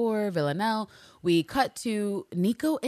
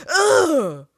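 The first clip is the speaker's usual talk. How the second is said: loud disgusted ugh, gross; annoyed ugh; 6 semitones higher, 9 dB louder than usual. Retold or imitated. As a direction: groan out your disgust. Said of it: "I was like".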